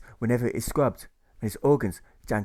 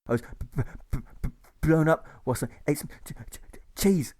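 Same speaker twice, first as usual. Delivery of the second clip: stammering; 4 semitones higher than usual. A narrator's reading. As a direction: stutter hard on b and ch. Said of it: "a peppy patient stammers".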